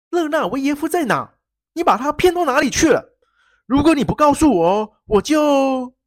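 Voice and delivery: loud, high, furious demand; furious shout. Acted. Prince shouting at the baron he just knocked down, furious. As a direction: bark out the threat at full volume. Sernine attacks and interrogates baron.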